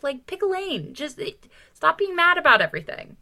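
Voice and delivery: exasperated. Real, not acted. Podcast host exasperated at a book critic. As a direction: throw up your hands, pick a lane.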